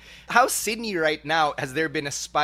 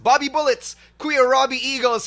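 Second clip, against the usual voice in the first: booming ring announcer intro, higher and louder; announcer hype; 8 semitones higher, 4 dB louder than usual. Acted. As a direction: introduce him like a big-match entrance. Hyping the guest's entrance.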